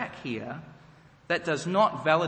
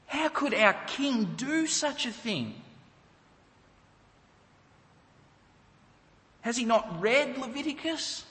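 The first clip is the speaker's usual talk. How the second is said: horrified disbelief; 7 semitones higher than usual. Pretend. Israelites horrified at their king.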